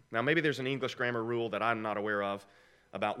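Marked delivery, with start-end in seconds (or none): none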